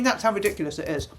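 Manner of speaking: usual speaking voice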